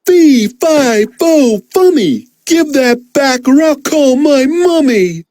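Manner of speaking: high roaring giant chant. Acted. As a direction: roar it like a giant. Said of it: "roared the giant".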